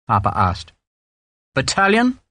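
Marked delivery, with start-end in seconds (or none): 1.5-2.2 BATTALION as a tentative question, rising high; higher-pitched, questioning suggestion; guessing. A narrator's reading. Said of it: "Sharp guessed".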